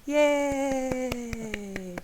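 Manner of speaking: high, drawn-out "yay"; celebratory cheer. Pretend. Game master to players once the wasps are dead, drawn-out cheer. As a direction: let the cheer stretch out high.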